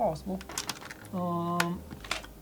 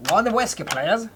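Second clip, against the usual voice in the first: put-on higher Scottish accent ordering whiskey; 5 semitones higher, slower than usual. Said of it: "Scottish accent".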